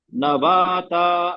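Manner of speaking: नबाता stretched very slowly, ta vowel held; teacher showing नबाता with the vowel held long; slow recitation. Shown as a demonstration. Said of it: "तो क्या करेंगे हम इसको".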